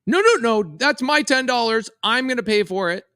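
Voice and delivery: high-pitched frantic mock protest; imitated child meltdown. Daughter protests as dad pays at the machine, mock freak-out. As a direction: act out a kid losing it. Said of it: "she loses her mind".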